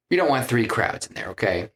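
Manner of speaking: ordinary talk, nothing marked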